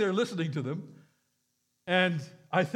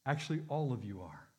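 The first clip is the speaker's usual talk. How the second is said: a fading, softer voice that trails away to near silence; gentle inner voice; 5 semitones lower, 5 dB quieter than usual. Retold or imitated. Spirit of God speaking to the crowd, fading softly. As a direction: let it sink like a whisper in the conscience. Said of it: "the Spirit of God was saying".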